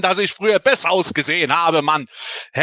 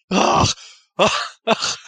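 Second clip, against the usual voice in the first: normal talking voice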